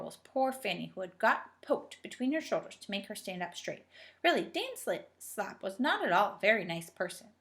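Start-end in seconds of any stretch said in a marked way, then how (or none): none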